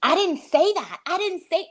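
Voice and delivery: high, whiny mock denial; mocking Lisa. Caller mimicking Lisa denying everything, high whiny mock. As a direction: imitate her protesting innocence, pitch up. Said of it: "she's like".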